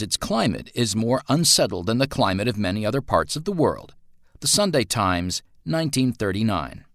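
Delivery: put-on animated, swooping old newsreel delivery; newspaper report read. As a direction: announce it like a news bulletin.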